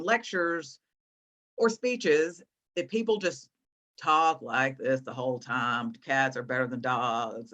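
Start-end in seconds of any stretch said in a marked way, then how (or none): 4.0-5.9 low, slow, unvarying drone; boring monotone. Shown as a demonstration. Coach showing students a dull lecture, monotone. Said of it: "it's kind of boring and monotone". 5.9-7.5 low, level lecture drone; boring monotone. Shown as a demonstration. Coach mimicking a dull debater for students, monotone. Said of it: "it's kind of boring and monotone".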